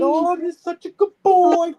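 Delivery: put-on high, slow baby-talk coo; mock cooing. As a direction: imitate him doting on his snake. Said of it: "He's being".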